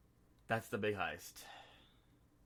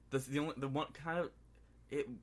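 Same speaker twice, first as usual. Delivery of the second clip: ordinary talk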